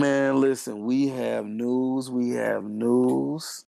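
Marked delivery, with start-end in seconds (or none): 0.8-2.1 slowly drawing out each word; slow announcement. Real, not acted. Hyping the podcast crowd, slow and grand. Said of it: "announcing news, drawn out". 2.1-3.7 stretched out, rising at the end; milk every word of it; very slow announcement. Real, not acted. Teasing the listeners with news, drawn out and rising. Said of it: "repeated announcement, slower".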